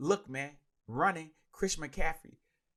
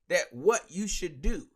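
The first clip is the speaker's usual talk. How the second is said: ordinary talk, nothing marked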